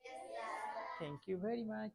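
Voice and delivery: soft student answer; a hint of a quiet student; mock student reply.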